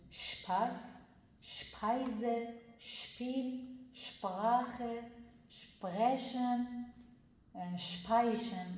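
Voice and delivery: شپاس with a firm sh, drawn out slowly; firm sh demo. Shown as a demonstration. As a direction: read شپاس slowly with a strong sh.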